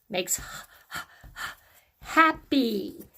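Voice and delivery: breathy h- h- h- then happy; isolated h sound. Shown as a demonstration. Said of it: "the H sound".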